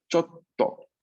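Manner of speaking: "Chotto" with a held stop on the double t before to; model word. Shown as a demonstration. Teacher models "Chotto" for students. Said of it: "duplico la T de to".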